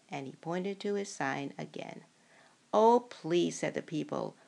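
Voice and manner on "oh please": loud pleading. Acted. Townspeople plead after waiting all day.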